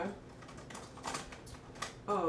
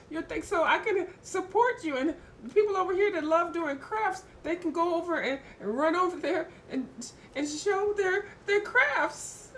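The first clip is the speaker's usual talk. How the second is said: high, upbeat appeal; excited pitch; 8 semitones higher than usual. Real, not acted. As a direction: hype up the crafters. Enthusiastic pitch to viewers.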